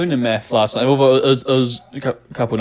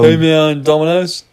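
light American surfer voice, a bit louder; American stoner accent; 3 semitones higher, 4 dB louder than usual. Retold or imitated. The painter greeting the pizza guy, light American accent. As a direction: greet the delivery guy in a lazy American drawl. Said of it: "we both slip into American accents".

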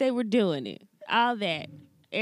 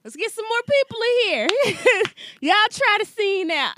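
excited calling out; 8 semitones higher, 4 dB louder than usual. Acted. Mock director calling out.

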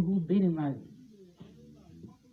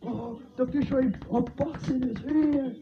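slow, higher mock voice of a fighter; mocking Jake Paul; 7 semitones higher, 4 dB quieter than usual. Mocking Jake Paul's post-fight words in a higher, slow voice. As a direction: lightly put on a dopey fighter voice. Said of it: "he goes, he goes".

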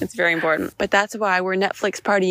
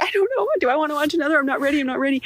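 high-pitched flustered protest; mock panic; 7 semitones higher, much faster than usual. Viewer rushed by autoplay into another episode, mock panic. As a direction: act out being rushed and unready, voice pitched up.

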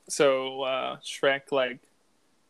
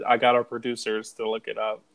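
normal talking voice

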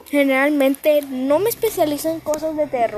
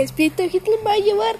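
high, fast panicked squeal; 8 semitones higher, 4 dB louder, faster than usual. Retold or imitated. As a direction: squeal in mock terror. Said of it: "empecé a chillar".

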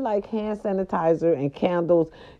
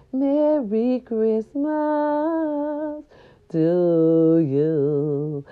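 lightly crooned, slow "Merry Christmas"; [name] voice; 4 semitones higher, much slower than usual. Retold or imitated. Host greeting her listeners, a light [name] croon. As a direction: croon it slowly like an old jazz singer. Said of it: "That was my [name] voice".

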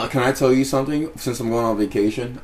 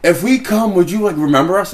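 excited women guests; 8 semitones higher, 5 dB louder than usual. Retold or imitated. Party guests asking a bouncer if he'd remember them, excited mimicry. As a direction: gush it in a raised mimicking pitch. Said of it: "they're like".

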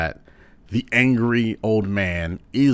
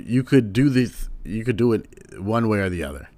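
ordinary delivery

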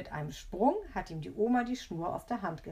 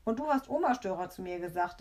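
ordinary delivery, nothing marked

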